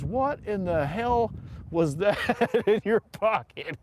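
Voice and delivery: high, slow, incredulous demand; amused imitation of a dressing-down; mock outraged sergeant. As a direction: draw it out like an outraged drill sergeant. Retold sergeant confronts recruit over roll.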